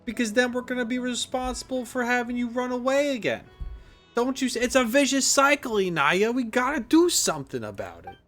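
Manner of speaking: high-pitched, exasperated mock lecture; mock scolding. Streamer arguing with a runaway game character, mock scolding.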